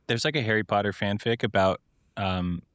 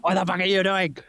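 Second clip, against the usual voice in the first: higher, hammed-up angry yell; mock Dumbledore yell; 10 semitones higher, 3 dB louder than usual. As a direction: yell like an outraged headmaster. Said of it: "Dumbledore shows up and screams".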